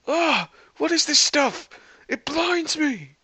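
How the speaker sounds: high-pitched theatrical alarm; startled outcry. A narrator's reading. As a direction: cry out as if suddenly blinded. Thor blasted mid-rescue by extinguisher.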